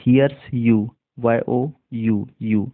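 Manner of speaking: ordinary talk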